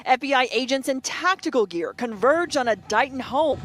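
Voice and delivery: normal talking voice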